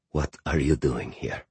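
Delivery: low, soft, tight question; quietly afraid. A narrator's reading. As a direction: Boris frozen with fear. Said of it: "as afraid as I'd ever seen him".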